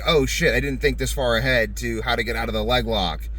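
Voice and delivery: usual speaking voice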